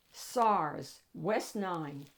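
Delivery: plain reading voice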